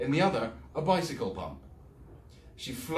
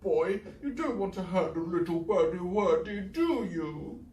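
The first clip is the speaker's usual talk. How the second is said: put-on honeyed baby talk; 10 semitones higher, 3 dB louder than usual. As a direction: sweet-talk the cat down. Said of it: "voice was thick and honeyed".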